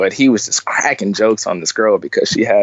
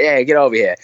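lightly mimicked Boston accent, a bit higher; imitated Boston accent; 5 semitones higher, faster than usual. Retelling how a man greeted him at the food trucks, imitated Boston accent. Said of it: "his Boston accent".

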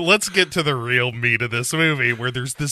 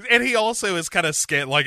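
usual speaking voice

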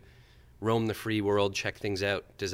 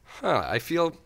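laughing; 7 semitones higher, 7 dB louder than usual. Retold or imitated. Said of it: "I was like".

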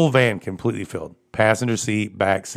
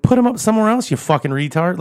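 much higher, loud insult; retold shout; 10 semitones higher, 4 dB louder than usual. Man retelling yelling at the woman in the van, loud and high. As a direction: yell it at her, fed up. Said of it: "I'm like".

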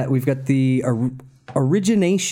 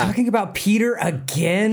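higher-pitched mock girlfriend voice; put-on weary disbelief, pitched up; fed-up girlfriend; 7 semitones higher than usual. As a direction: play the friend who has heard it all.

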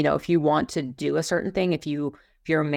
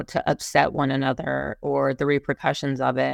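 usual speaking voice

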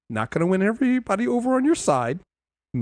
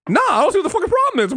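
higher, faster dismissive retort; a lightly voiced brash god, quick and higher; brusque Khonshu; 9 semitones higher, faster than usual. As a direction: brush off the complaint quickly.

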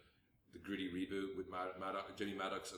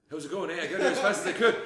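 put-on louder, laughing Canadian accent; hammed-up Canadian greeting; 8 semitones higher, 14 dB louder than usual. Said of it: "the whole Canadian accent thing".

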